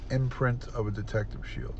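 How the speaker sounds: ordinary talk, nothing marked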